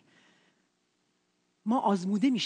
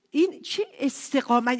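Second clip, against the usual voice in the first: normal talking voice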